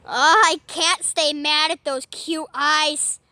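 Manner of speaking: ordinary talk, nothing marked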